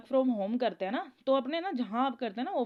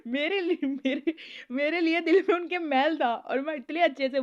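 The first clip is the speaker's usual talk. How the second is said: louder, higher, words pushed out through laughter; laughing while talking; 3 semitones higher, 6 dB louder than usual. Real, not acted. Telling viewers a grim story, laughing through it. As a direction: talk through the giggles you can't stop. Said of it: "मुझे हंसी आ रही है ना".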